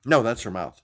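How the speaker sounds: ordinary delivery, nothing marked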